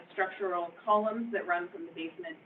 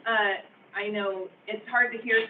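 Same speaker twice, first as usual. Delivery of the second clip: normal talking voice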